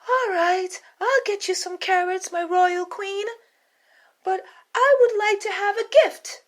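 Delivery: a little rabbit striking a deal; eager high rabbit. Acted. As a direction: rabbit bargaining with the queen in a squeaky voice. Rabbit bargains with queen.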